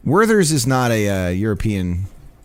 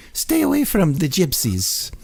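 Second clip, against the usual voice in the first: put-on Italian accent; 6 semitones higher than usual. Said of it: "I just need an Italian accent".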